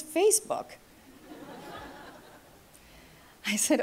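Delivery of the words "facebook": high, light voice for mom's answer; mother explaining how she knew, lightly voiced; mock mother's reply. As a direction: toss it off brightly as the obvious answer.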